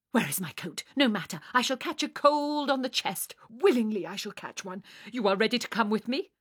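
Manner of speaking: loud, agitated, self-sacrificing professor; flustered Herr Professor. Acted. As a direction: bustle heroically off to the rescue.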